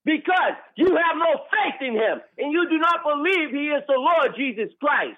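high-pitched, furious denunciation; real high, strained shout; yelling. Real, not acted. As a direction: cry out the accusation in anger. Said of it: "You yelling again".

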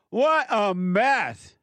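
loud, high, slow exclamation; drawn-out cry of dismay. Real, not acted. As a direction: stretch it out in disbelief. Exclaims over the situation.

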